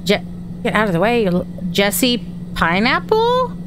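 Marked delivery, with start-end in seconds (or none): 1.7-3.6 slow JESSE, PINEAPPLE swooping up high; puzzled name reading. Real, not acted. Player reading an odd name off a body, slow and puzzled. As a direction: draw it out as a doubtful question.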